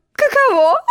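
high, squealing giggle; giggling queen. Acted. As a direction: giggle at her own boast.

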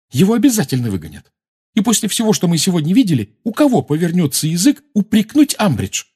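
high-pitched, heated argument; angry. A narrator's reading. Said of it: "сердито сказала Гермиона". (сердито, повышенным тоном)

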